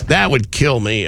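ordinary talk

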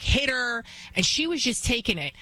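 normal talking voice

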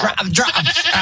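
high, sharp commands to an animal; commanding a dog. Acted. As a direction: order the goat to drop it. Commanding goats, cut off.

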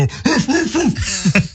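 imitated friendly barking, high woofs; loving dog bark. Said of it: "in a loving way go".

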